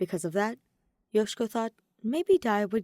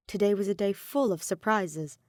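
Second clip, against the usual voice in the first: plain reading voice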